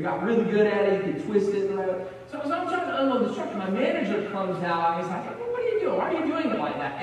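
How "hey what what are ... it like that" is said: high, sharp boss voice imitated; mock the nosy manager's challenge; manager's snippy tone. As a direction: boss catching a worker doing it wrong, high and sharp. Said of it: "it was just his tone".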